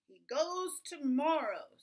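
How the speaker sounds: loud, slow, final pronouncement; father's verdict. A narrator's reading. Father refusing the children's pleas, loud and final. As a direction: boom it out firmly, stretching "tomorrow". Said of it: "said Father".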